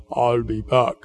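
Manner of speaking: put-on low Austrian-accented movie line; Arnold Schwarzenegger accent. Preacher giving the congregation the famous film line, Austrian accent. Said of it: "without even getting the accent".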